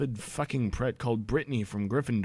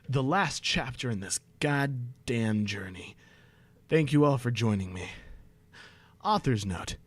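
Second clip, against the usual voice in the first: usual speaking voice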